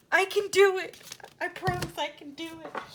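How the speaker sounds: high, loud burst of determination; eager insistence. Retold or imitated. Promising him she can help with the snake, eager insistence.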